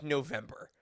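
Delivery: normal talking voice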